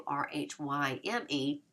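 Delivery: slow letter-by-letter spelling R H Y M E; spelled out. Shown as a demonstration. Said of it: "and rhyme".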